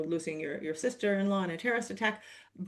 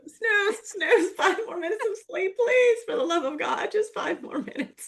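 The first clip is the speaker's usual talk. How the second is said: mock pleading sleeper; 10 semitones higher than usual. Begging alarm for more sleep.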